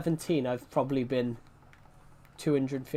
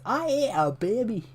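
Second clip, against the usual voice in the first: high, put-on Scottish villain; gleeful boast in a hammed-up voice; lightly voiced Austin Powers brute; Fat Bastard; 8 semitones higher than usual. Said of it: "English colonel telling me to lose weight".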